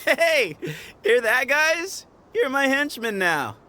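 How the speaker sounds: gleeful call-out. Acted. Gleefully calling to companions.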